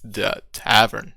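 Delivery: grand, emphatic tavern welcome; game master scene setting. Acted. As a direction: announce each delight with mounting showmanship, then settle.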